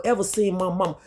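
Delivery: ordinary talk, nothing marked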